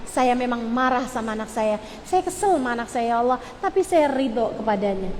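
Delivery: low, subdued confession to God; demonstrating a restrained parent's plea; model prayer. Shown as a demonstration. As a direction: pray softly through the anger. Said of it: "di saat marah kamu bilang".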